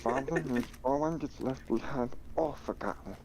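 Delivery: high raspy alien voice; Stitch voice. Retold or imitated. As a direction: squeeze it up like Stitch. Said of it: "I can still kinda do Stitch".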